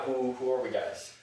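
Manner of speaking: ordinary delivery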